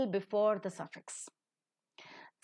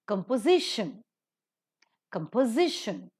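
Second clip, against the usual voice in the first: "Composition" stressed on SI; stress before the suffix. Shown as a demonstration. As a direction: lean on ZI in each "Composition". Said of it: "these words that are pronounced".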